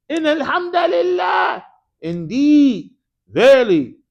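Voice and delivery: high, loud proclamation; emphatic declaration. Shown as a demonstration. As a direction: ring it out with full conviction. Demonstrating the emphatic particle inna.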